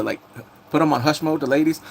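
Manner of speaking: usual speaking voice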